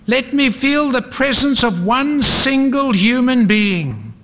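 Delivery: high, desperate plea; yelling. A narrator's reading. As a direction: cry out high, begging for company. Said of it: "he yelled".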